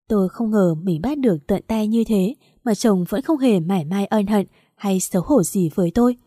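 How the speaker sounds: ordinary delivery, nothing marked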